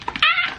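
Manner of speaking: short, very high happy squeal; delighted squeal. Real, not acted. Vlogger overjoyed by friends' gifts, delighted squeal. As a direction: yelp high with sheer delight.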